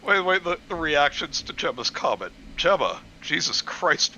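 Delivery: ordinary delivery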